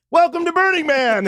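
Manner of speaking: very high, loud mock-triumphant welcome; spring the prank with glee; gleeful welcome. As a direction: burner reveals the prank, gleeful. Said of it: "And he goes".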